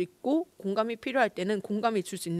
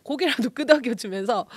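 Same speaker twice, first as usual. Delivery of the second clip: ordinary delivery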